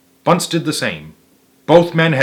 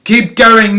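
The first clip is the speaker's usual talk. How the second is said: higher, louder shout; 9 semitones higher, 8 dB louder than usual. A narrator's reading. Fat Boggis shouts to Bean, higher and louder. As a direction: yell over the engines. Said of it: "Boggis shouted".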